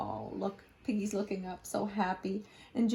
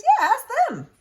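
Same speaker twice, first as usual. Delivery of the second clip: Piggy voice; 10 dB louder than usual. Acted.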